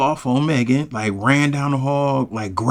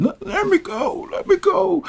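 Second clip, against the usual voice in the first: put-on much higher, whining like a little kid. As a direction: beg like a child, pitched way up. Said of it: "she like a little kid".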